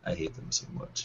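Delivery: hushed, breathless aside; whispered. Real, not acted. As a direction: whisper it under your breath. Muttered hatred of rival uniforms.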